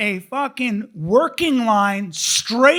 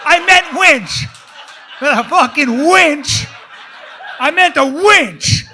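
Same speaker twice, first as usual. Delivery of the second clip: loud, higher, punching WINCH; mock-indignant correction; 5 semitones higher, 6 dB louder than usual. Comic corrects his own slip to the crowd, mock-indignant. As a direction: insist on it with comic exasperation. Mock-insisting after saying wrong word.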